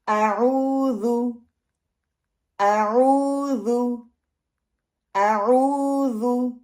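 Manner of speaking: slow AOODHU with long OO, short final U; correct recitation. Shown as a demonstration. Teacher modelling आऊज़ु for practice, slow and drawn out. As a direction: draw out the OO in आऊज़ु, keep the last vowel short. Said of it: "इसको सामने रखते हुए practice करें".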